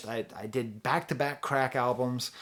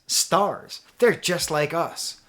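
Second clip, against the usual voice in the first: slow, louder magazine-cover announcer; tabloid headline voice; 4 semitones higher, 5 dB louder, much slower than usual. Retold or imitated. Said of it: "what People magazine tells us".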